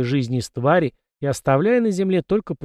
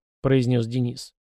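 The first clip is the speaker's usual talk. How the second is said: ordinary delivery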